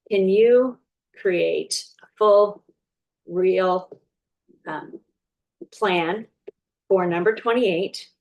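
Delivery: slow, halting dictation, thinking up each word as she speaks; slow dictation to ChatGPT. Shown as a demonstration. Coach typing a prompt live for viewers, slow and halting. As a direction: spell out the prompt slowly, pausing between words. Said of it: "I'm gonna say".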